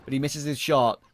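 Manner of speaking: loud, energetic esports casting; play-by-play. Real, not acted. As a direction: call the action live and punchy.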